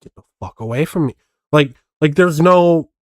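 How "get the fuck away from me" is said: mock panic, low and toneless; uninflected protest. Mimicking the man's fake panic, uninflected protest. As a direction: run off the protests softly without any alarm. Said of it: "there's no inflection to it".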